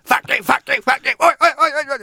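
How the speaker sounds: imitating a punk band's shouted chant. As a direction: bark it like a punk singer on stage. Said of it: "You know, like that".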